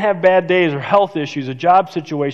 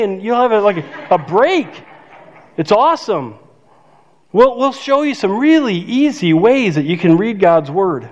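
normal talking voice

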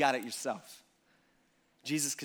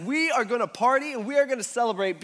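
higher and louder, celebrating; excited father; 6 semitones higher, 5 dB louder than usual. Retold or imitated. The father announces a party, excited and louder. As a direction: throw the party open with joy. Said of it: "he's all excited".

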